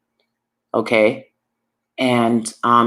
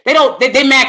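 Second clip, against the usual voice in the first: raised, insistent voice; shouting it; 7 semitones higher, 7 dB louder than usual. Real, not acted. Vlogger answering critics of her rant, raised voice. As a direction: push it out loud and high at first. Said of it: "I'm shouting it".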